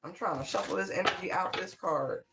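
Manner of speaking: ordinary delivery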